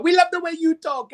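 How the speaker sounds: high-pitched screamed imitation of a heckler; mock audience scream. Audience member calling out to the comedian, mock scream. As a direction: imitate a fan yelling out, way up high. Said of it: "someone screams out".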